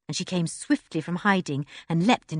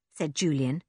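ordinary delivery

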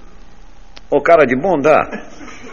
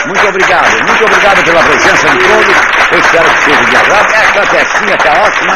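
much higher, louder tipsy thank-yous; comic drunk host farewell; 12 semitones higher, 6 dB louder than usual. Acted. Comedian signing off to the audience as a tipsy host. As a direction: slur the goodbyes like a tipsy host.

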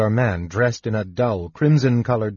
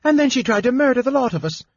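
much higher, fast, worked-up accusation; indignant outcry; 13 semitones higher, much faster than usual. Acted. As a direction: rattle it off in outrage. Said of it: "cried the baker".

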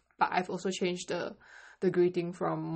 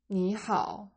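usual speaking voice

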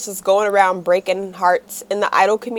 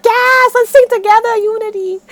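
excited fan; 11 semitones higher, 4 dB louder than usual. Retold or imitated. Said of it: "I'm like".

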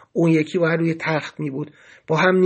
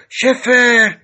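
short raised, louder shout; shouted call; 4 semitones higher, 6 dB louder, much slower than usual. A narrator's reading. Prisoner yelling for the guard, bold shout. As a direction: call out boldly to someone across the way. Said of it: "در صداش بود داد زد".

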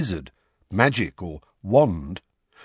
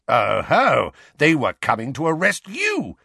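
gloating, higher and louder; triumphant; 6 semitones higher, 4 dB louder than usual. Acted. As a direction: crow over your conclusion. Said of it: "with the triumphant air".

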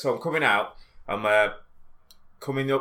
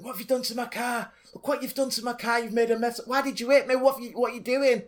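put-on high Irish old lady scolding, pitched well up; 13 semitones higher than usual. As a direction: nag like an angry little Irish granny. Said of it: "Irish accent".

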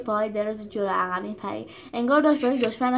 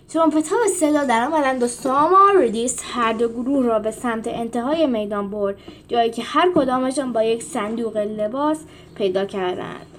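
ordinary delivery, nothing marked

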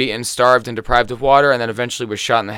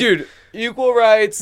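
high, slow mock bro voice; mocking high bro drawl; mock dude voice; 9 semitones higher, much slower than usual. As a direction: drag it out like a dumb bro. Mocking voice of the killer.

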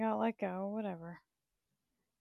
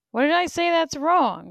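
higher, louder confused question; baffled outcry; 8 semitones higher, 11 dB louder than usual. Real, not acted. Asking chat loudly what she said wrong. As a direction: demand to know what went wrong.

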